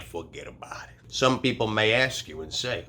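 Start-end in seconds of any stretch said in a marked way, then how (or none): 0.0-1.0 Brooklyn accent. Shown as a demonstration. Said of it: "making sure you got the Brooklyn accent".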